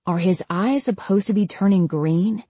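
usual speaking voice

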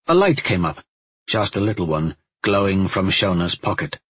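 ordinary delivery

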